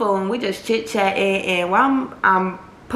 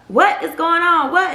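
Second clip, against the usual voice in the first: alarmed mom; 9 semitones higher, 4 dB louder than usual. Retold or imitated. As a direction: play a mom demanding answers. Mom reacting on the phone.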